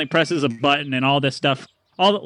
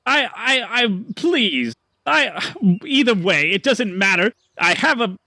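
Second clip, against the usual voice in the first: lightly raised huffy salesman; flustered merchant; 8 semitones higher than usual. Acted. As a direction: regain composure, a bit prissy. Merchant keeps talking.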